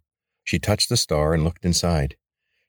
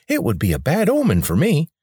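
much higher, superstitious and haughty; a high grating voice, proud and wary; haughty rebel leader speaking high; 10 semitones higher, 3 dB louder than usual. A narrator's reading.

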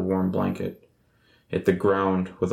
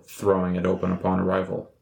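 normal talking voice

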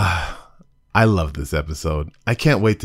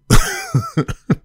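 high, loud burst of laughter; 5 dB louder than usual. Real, not acted. Reviewer laughing at her own scare, high loud laugh. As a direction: laugh at yourself, high and bright.

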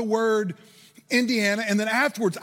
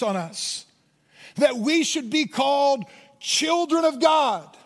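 preaching; 4 semitones higher, slower than usual. Acted. Preacher urging his congregation, slow rising preaching. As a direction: press the truth home to the church.

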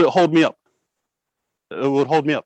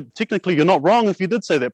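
normal talking voice

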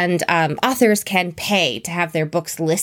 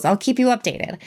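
ordinary talk, nothing marked

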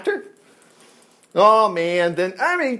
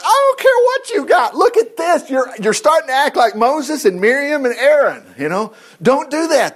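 much higher, louder preaching rebuke; 8 semitones higher, 4 dB louder than usual. Real, not acted. Preacher rebuking a doubting listener, loud high rebuke. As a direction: cut them off and preach it hard.

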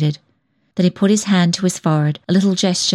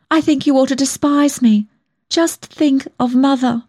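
high-pitched tearful self-reproach; sobbed; 7 semitones higher than usual. A narrator's reading. Young woman confessing shame to her lover, in a high sobbing voice. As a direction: plead it high, close to sobbing. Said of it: "I sobbed".

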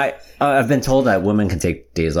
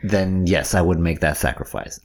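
usual speaking voice

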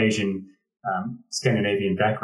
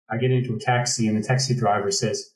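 ordinary talk, nothing marked

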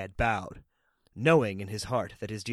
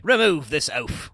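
disdainful order; mildly raised command; imperious cry; 3 semitones higher, 4 dB louder than usual. A narrator's reading. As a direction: order it as a haughty lady. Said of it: "she cried".

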